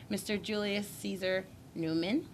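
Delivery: ordinary delivery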